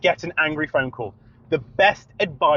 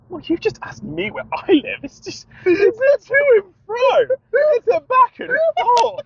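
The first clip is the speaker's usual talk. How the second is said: much higher, slow, sing-song lecturing; mock patient explaining; 3 dB louder than usual. Lecturing the irate caller, higher and slow. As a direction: explain it like to a child.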